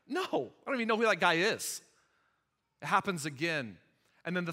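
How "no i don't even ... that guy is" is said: higher, dismissive disavowal; emphatic denial. Acted. Peter denies Jesus to servant girl, emphatically. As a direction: blurt a firm denial.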